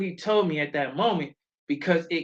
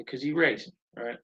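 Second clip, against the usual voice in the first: usual speaking voice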